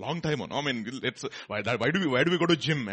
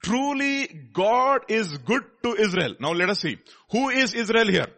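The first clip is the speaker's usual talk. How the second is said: emphatic preaching; 8 semitones higher, 7 dB louder than usual. Real, not acted.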